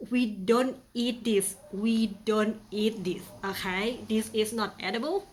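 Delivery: ordinary talk, nothing marked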